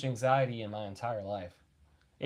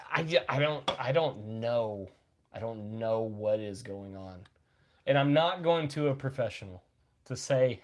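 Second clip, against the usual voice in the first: ordinary delivery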